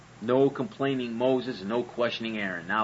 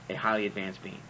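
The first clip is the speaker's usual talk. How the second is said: lower, softer, mocking echo of highly; sarcastic repeat; 4 semitones lower, 4 dB quieter than usual. Shown as a demonstration. Mocking the idea of an all-knowing God, sarcastic. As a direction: drop the voice and sneer at highly advanced.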